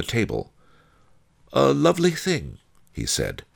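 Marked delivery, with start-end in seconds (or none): 1.5-2.6 high, appreciative character voice; admiring sage. A narrator's reading. Master Li showing off the jade earring, high and admiring.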